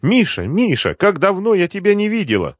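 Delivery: high-pitched cry of feigned joyful recognition; радостный крик. A narrator's reading. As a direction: call out high and loud as if to a long-lost love. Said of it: "с криком". (радостно, высоким криком)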